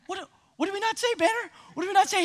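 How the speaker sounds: quick, high retold questioning; Katie. Preacher voicing Katie asking the little boy, high and puzzled. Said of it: "Katie's like".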